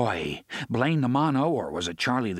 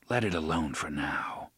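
deeper, quiet and steady; Roland, low voice; 7 semitones lower, 4 dB quieter than usual. A narrator's reading. Roland telling Eddie to drop it, low and quiet. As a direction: settle it with low authority.

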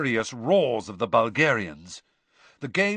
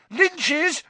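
high, excited cry; yell; 3 dB louder than usual. A narrator's reading. Harry correcting his friends as seeker falls, yelling. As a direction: yell the correction over the others. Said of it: "yelled Harry".

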